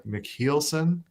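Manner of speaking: McKeelson slow, middle sounding like heel; drawn-out heel pronunciation. Shown as a demonstration. Singer shows how others call him, [name] like heel. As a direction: stretch McKeelson with a long ee. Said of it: "emphasis on the H".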